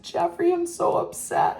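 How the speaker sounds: very high, breathy mock mom voice, upset; imitating mom. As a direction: squeak it out like a distraught mother. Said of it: "and she said".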